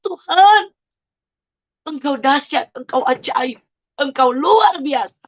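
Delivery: high-pitched cry of praise, retold; retold tearful cry. Retelling her prayer to God at the ultrasound, tearful cry. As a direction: call out to God as if overcome. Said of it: "Di situ saya menangis sehebat-hebatnya".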